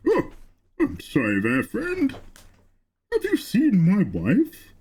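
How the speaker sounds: put-on jolly old knight with swooping pitch; cheerful, bumbling old knight; Siegmeyer impression. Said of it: "Can you do Siegmeyer's impression".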